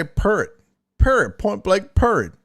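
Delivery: "purrit" said as two syllables, with no final d; "purrit" in place of "period"; purrit pronunciation. Shown as a demonstration. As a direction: clip "purrit" short. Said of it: "that's how I say".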